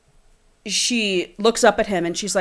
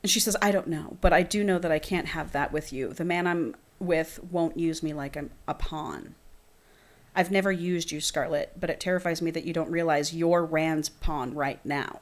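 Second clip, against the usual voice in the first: reading a romance novel aloud, a bit softer; dramatic book reading; 3 semitones lower, 8 dB quieter than usual. Acted. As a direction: read the scene like an audiobook.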